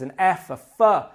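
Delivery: "F" named, then sounded as fuh; teacher's demo of the "F" sound. Shown as a demonstration. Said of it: "The first sound is".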